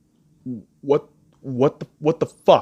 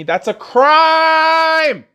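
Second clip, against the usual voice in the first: drawn-out, much higher, loud sarcastic cry of crime; mock outrage; 12 semitones higher, 6 dB louder, much slower than usual. Vlogger mocking looters' excuses, in drawn-out mock outrage. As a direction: stretch it out in exaggerated fake shock. Sarcastic outburst about looting.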